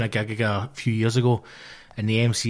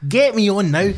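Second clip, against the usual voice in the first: insistent shout; 7 semitones higher, 5 dB louder than usual. Retold or imitated. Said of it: "I'm shouting".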